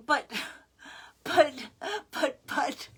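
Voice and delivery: imitating a lost-for-words objection; retold stammering protest. Retold speechless protest at faint praise.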